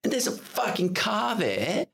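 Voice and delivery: ordinary talk, nothing marked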